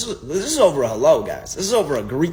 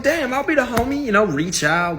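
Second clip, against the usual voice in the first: inner voice quote; 11 semitones higher than usual. Retold or imitated. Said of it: "So I'm like".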